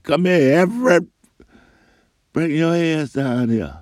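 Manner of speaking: high, loud taunting call; bully shouting for the boy to come down. Retold or imitated. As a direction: yell up to him from the yard. Bully calling out from the porch.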